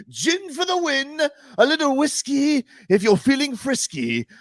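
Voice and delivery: lilting high sing-song recital; a hint of a pompous poet; mock rhyming verse. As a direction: recite it like a smug little poem. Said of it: "Was that a poem?".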